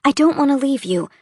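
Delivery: distressed. Acted. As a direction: rush it out in rising panic. Narrator's distressed inner protest.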